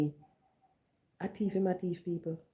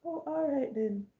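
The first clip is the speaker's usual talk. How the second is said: stunned acceptance; 8 semitones higher, 5 dB quieter than usual. Retold or imitated. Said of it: "Because I was shocked".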